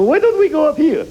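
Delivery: high, loud mock officer; a hint of a bumbling commander; mock Iraqi general.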